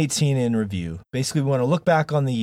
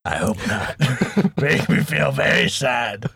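pirate voice; slower than usual. Acted. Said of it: "I was matching you with the pirate voice".

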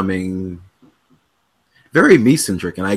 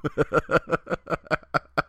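laughing; 7 semitones higher, 7 dB quieter than usual. Real, not acted. Laughing at start of podcast.

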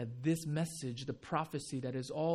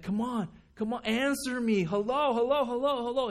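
higher, louder calls of hello; impatient calling out; 9 semitones higher, 7 dB louder than usual. Retold or imitated. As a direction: knock on heaven's door, impatient. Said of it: "you're like".

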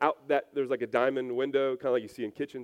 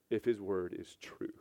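soft unvoiced whisper; hushed whisper; 4 semitones lower, 8 dB quieter than usual. Real, not acted. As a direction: breathe it like a secret. Intimate aside.

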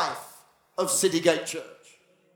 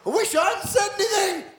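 high, laughing mock regret; panicky, giggling whine; 12 semitones higher than usual. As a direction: squeal it out through laughter as a cowering prophet. Terrified Elijah, laughing.

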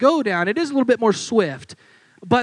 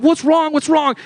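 urgent call; 7 semitones higher, 5 dB louder than usual. Retold or imitated. Runs up to a panicking student.

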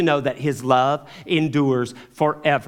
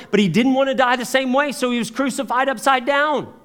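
much higher, louder, building preacher's fervour; rising preaching; 9 semitones higher, 6 dB louder than usual. Real, not acted. Preacher telling his class of Peter's joyful martyrdom, rising louder and higher. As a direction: swell with excitement as the story climbs.

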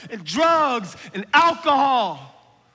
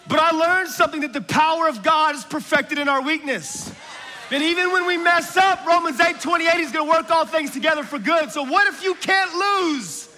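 higher, louder, shouting preacher; preaching at full force; 6 semitones higher, 3 dB louder than usual. Acted. Preacher rallies the congregation to courage, full-force preaching. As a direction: proclaim it with fire to the room.

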